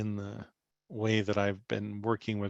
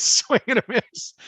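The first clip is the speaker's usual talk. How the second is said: laughing high and loud; 13 semitones higher, 11 dB louder than usual. Retold or imitated. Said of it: "trying not to laugh hysterically".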